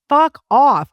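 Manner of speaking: a high, loud, fed-up blurt at the grown-up; mock angry retort. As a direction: toss it off like an irritated kid. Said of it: "I'd be like".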